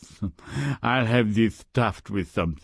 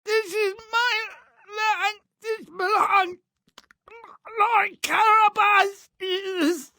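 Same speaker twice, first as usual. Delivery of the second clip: ogre voice; 4 dB louder than usual. Acted. Ogre answers in booming voice.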